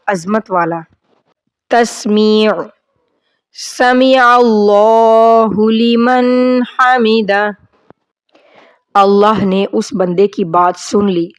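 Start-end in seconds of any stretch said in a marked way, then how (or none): 3.6-7.5 slow, drawn-out Arabic recitation. Shown as a demonstration. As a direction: recite each word slowly and clearly. Teacher recites prayer slowly.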